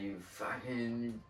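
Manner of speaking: slow, dragged-out mock rant of a raging farmer; lightly hammed-up angry ranting; imitated rant. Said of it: "going off on a schizophrenic rant".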